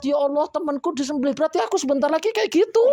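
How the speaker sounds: high, fast, panicky cow voice; a cow begging in fear as it watches; crying cow. Retold or imitated. A preacher voicing a cow weeping as its friend is slaughtered, high and panicked. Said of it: "Nangis melihat".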